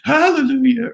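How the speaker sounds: very high, sung-out mock rejoicing; hammed-up ecstatic falsetto; exaggerated excited wife. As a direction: imitate an overjoyed wife, way up high. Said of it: "she's just the most excited thing on the planet".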